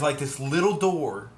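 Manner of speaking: normal talking voice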